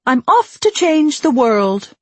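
high, slow proclamation; grand declaration. A narrator's reading. Radicalized Piggy announces she is off to change things, grand declaration. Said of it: "she declares".

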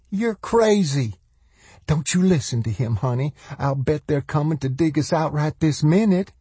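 high-pitched, defiant woman; voiced woman. A narrator's reading. Mother telling her child not to listen, high voice.

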